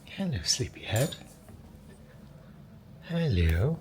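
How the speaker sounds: high, sing-song hello to a sleepy puppy. Real, not acted. Owner greeting her sleepy puppy in a baby voice. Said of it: "my baby voice on the internet".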